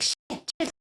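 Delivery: whispered panicked swearing. Retold or imitated. Caught spying by strangers, panicked whisper. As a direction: hiss it under your breath.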